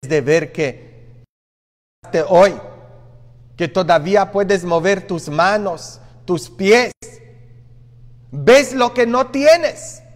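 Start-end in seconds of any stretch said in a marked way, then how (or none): none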